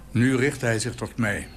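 ordinary delivery, nothing marked

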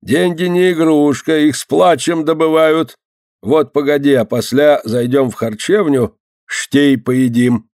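put-on drawn-out old man's voice; lightly scolding elder, stretching the words; lecturing old man. A narrator's reading. Old man to girl about money, admonishing.

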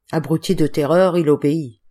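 usual speaking voice

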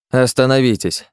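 put-on the old man repeating his order to the guards; repeated shout. A narrator's reading. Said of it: "крикнул выскочивший на улицу".

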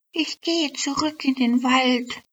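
high, light fairy voice; a homesick elf, pitched well up; little elf. Acted. Elf telling her neighbour she's leaving, high and light. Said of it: "sagte die Elfe zu einer Nachbarelfe".

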